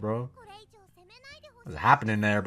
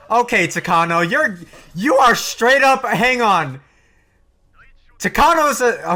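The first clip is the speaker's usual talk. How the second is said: shocked outburst; 9 semitones higher, 6 dB louder than usual. Real, not acted. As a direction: voice jumps high and loud in disbelief. Shocked at Takano gassing villagers.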